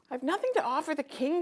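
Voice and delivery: put-on high, small child voice; little girl. Girl telling her brother she has nothing, high child voice.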